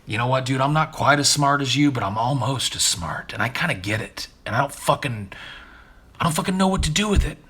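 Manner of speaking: the half-smart bird venting, getting worked up; playful parrot monologue building to an exasperated outburst; parrot's inner voice. Retold or imitated. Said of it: "you get a sense from 'em that".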